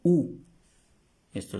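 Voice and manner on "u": "U" said loud and high as oo; French où. Shown as a demonstration. Teacher modelling the French où. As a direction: call out "U" like French où. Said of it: "se pronuncia igual".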